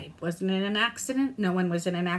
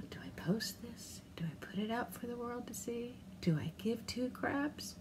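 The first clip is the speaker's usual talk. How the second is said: much softer conspiratorial whisper; 13 dB quieter than usual. Real, not acted. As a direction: whisper the doubts to yourself. Whispering asides to viewers.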